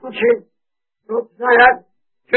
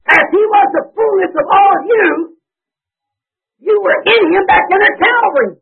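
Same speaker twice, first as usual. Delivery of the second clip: preaching at full force; 7 semitones higher than usual. Real, not acted. Preacher shouting the gospel to the church. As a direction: cry it out over the congregation.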